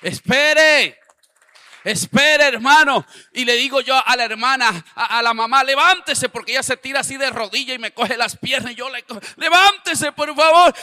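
high, very loud preacher's cry; preaching shout. Acted. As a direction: shout it from the pulpit.

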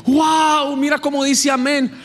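excited mock praise; high, excited voice acting out the joyful fig tree. Predicador imita la higuera feliz. (voz aguda y entusiasmada)

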